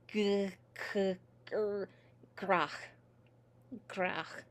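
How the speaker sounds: g, k, gr built up into grach; consonants pulled together. Shown as a demonstration. Teacher showing consonants pulled into each other, blended. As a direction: isolate the opening sounds, then run them into grach. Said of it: "pulled into each other".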